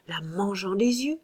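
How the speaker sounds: usual speaking voice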